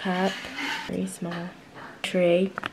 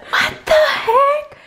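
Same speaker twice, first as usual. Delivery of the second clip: high, slow disbelieving squeal; shocked; 4 dB louder, slower than usual. Real, not acted. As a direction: react in total disbelief. Surprised by odd object.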